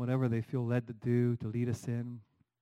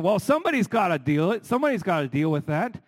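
mock-indignant voice, higher and louder; imitating critics; 6 semitones higher, 7 dB louder than usual. Preacher imitating loud critics on TV, mock indignant. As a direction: play the self-righteous crowd, half mocking. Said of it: "and they're saying".

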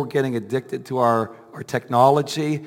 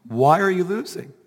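higher, louder fervent preaching; 5 semitones higher, 3 dB louder than usual. Acted. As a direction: build to a full-force sermon climax. Rising altar-call exhortation.

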